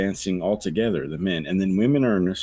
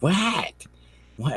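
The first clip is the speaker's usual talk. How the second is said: mock innocent wife; 8 semitones higher than usual. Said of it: "she's like".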